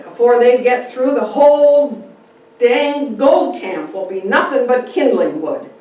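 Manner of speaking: mildly exasperated bystander complaining; lightly voiced grumbling man. Man grumbling during fire.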